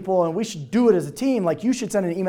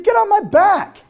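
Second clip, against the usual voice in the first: high, slow bark of an order; retold command; 13 semitones higher, 4 dB louder, slower than usual. To Dave who skipped Super Saturday, a slow high order. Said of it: "I said".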